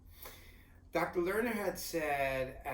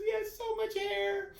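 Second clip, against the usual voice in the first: much higher, slowed wonder; awed wife; slower than usual. Retold or imitated. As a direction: repeat the doctor's words in a high, awed voice. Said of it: "Tanya looks at me and goes".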